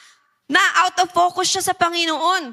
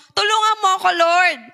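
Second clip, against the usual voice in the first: pleading call; 4 semitones higher, 4 dB louder than usual. Acted. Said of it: "Yung sasabihin mo".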